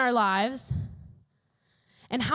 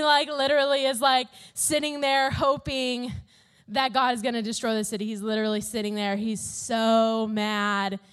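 normal talking voice

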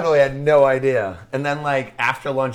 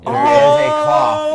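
alarmed shout; 13 semitones higher, 9 dB louder, much slower than usual. Real, not acted. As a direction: shout it out, shocked. Spill just happened.

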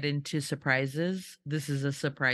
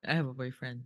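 quiet deadpan repeat; 6 semitones lower, 7 dB quieter, much faster than usual. Retold or imitated. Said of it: "every interview".

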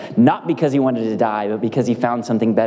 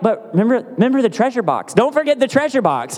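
pleading dad; 11 semitones higher than usual. Retold or imitated. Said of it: "And I'm like".

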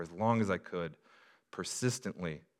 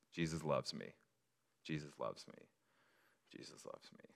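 each repeat softer, the last a bare whisper; fading to whisper; 4 semitones lower, 14 dB quieter than usual. Shown as a demonstration. Pastor showing how he whispered it at school. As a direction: fade it down to a breath. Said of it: "whispering it to yourself".

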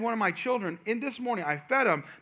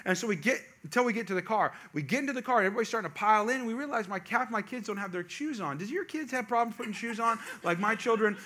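ordinary talk

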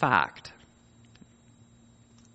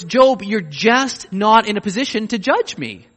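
higher and louder, firm; God telling Job he cannot judge, firm and raised; stern divine rebuke; 6 semitones higher, 5 dB louder than usual. Acted. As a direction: lay down the verdict to Job. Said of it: "In other words".

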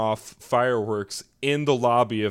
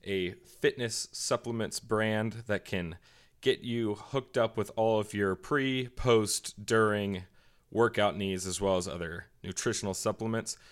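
sponsor ad read; 6 dB quieter than usual. Real, not acted. Podcast host reads fitness supplement sponsor to listeners, ad read. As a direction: read the sponsor copy evenly and quietly.

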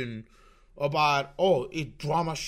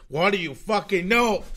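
angry retort; 5 semitones higher, 6 dB louder than usual. Acted. Repeated retort to chat, louder.

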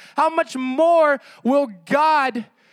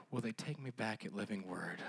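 much softer, deflated joking aside; mock despair; 13 semitones lower, 22 dB quieter than usual. Pastor jokes to the church about an empty room, deflated and much softer. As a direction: sink into a crushed little mutter. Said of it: "no, I'm just kidding".

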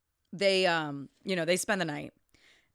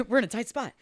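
quick quoted line, a bit higher; movie quote corrected; 4 semitones higher, much faster than usual. Retold or imitated. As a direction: toss off the famous line quickly. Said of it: "everything in Oh Brother, Where Art Thou is".

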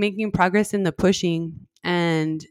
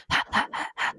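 breathy, voiceless mock laughter; soft, airy imitation of laughing; imitated choked laugh; 9 dB quieter, faster than usual. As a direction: imitate someone gasping out little ha-ha sounds. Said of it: "I could hear her, like, going".

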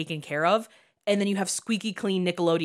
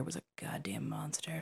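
whispering; 14 dB quieter than usual. Real, not acted. Whispered insult about Dan Schneider.

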